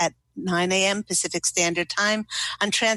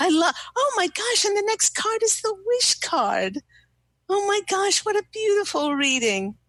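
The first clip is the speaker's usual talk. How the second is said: much higher, gushing with real delight; can barely contain her joy at the card; thrilled; 13 semitones higher than usual. Real, not acted. Psychic to caller, thrilled the next card is the wish card, squealing with delight.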